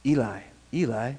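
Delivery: Eli with a long EE at the start, said low; "Eli" pronounced EE-lie, softly; alternate pronunciation. Shown as a demonstration. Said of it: "different way to pronounce it".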